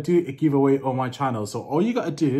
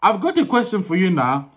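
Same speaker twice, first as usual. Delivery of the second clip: higher, louder Nigerian accent; raised Nigerian-accented question; 7 semitones higher, 5 dB louder than usual. Shown as a demonstration. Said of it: "they pronounce it quite differently".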